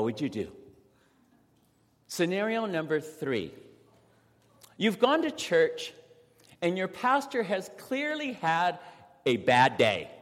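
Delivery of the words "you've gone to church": normal talking voice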